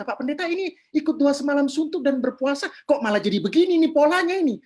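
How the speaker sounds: protes bingung bernada tinggi. Retold or imitated. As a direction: bertanya heran dengan nada naik. Menirukan penanya yang bingung. (bingung, nada tinggi)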